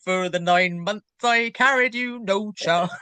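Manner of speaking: a hint of a country and western twang; mock country singer accent, lightly done. Friend jokes with co-host in a mild country and western accent. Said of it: "I don't know why I put that accent on".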